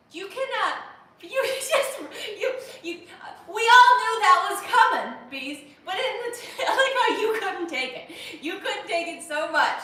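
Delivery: high, loud laughing outburst; cracking up at a chatter; roaring with laughter; laughing shout. Real, not acted. Laughing at chat pun reaction.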